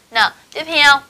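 normal talking voice

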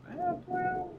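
high call to another room; yelling across the room. Real, not acted. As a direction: call it out to someone far off. Calling to child in another room.

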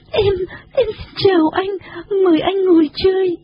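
high, tearful little Nhà Trò voice; tearful polite greeting. A narrator's reading. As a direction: greet politely through acted sobs, pitched high. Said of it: "nước mắt đầm đìa, rồi cúi chào lễ phép". (giọng cao, mếu máo chào lễ phép)

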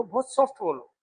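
softly spoken demonstration of polite speech; soft, शालीन बोली. Shown as a demonstration. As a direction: say it softly, showing how to speak decently. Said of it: "ऐसे जोर जोर से मत बोलो". (नरम, धीमी आवाज़ में)

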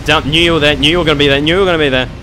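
annoyed loud complaint; frustrated outburst. Real, not acted. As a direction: vent it at the enemy that ambushed you. Frustrated at ambush.

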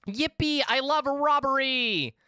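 high, drawn-out playful cheer; gleeful cheer. Pretend. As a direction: cheer it slowly in a high, giddy voice. Gleeful cheer about the robbery.

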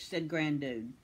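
plain reading voice

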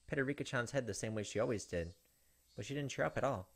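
ordinary talk

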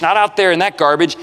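normal talking voice

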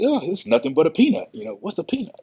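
high, breezy simple quote; Ronnie impression. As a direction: toss it off like it's nothing. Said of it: "those simple quotes".

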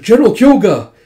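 high, loud urgent shout; calling out. Retold or imitated. Soldier to his general in battle, calling out. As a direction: yell for the general's attention.

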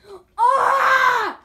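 high strained shout; mock raging cry. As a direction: push into a fake tantrum yell. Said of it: "pretending like I am".

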